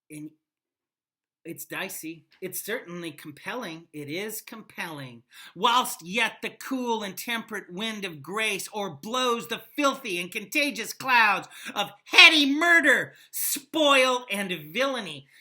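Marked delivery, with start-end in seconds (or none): 5.6-15.3 loud, declaiming Shakespeare read; dramatic recitation. Acted. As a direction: proclaim it like a king at the gates. Reads Henry V speech aloud.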